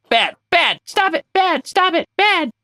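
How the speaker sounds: high, firm scolding of a pet; playfully telling off the misbehaving wheel; mock scolding. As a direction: scold it like a naughty puppy.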